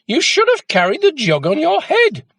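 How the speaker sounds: very high, loud mocking shriek; shouted insult. A narrator's reading. Mother scolding Jack over spilt milk, shrieking. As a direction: yell it at the silly boy. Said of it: "shouted his mother".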